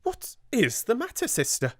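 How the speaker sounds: alarmed high cry of "What is the matter". Acted. Amelia, startled by her sister's angry face, alarmed. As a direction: start back in alarm, voice jumping up. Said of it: "fell back a step in alarm".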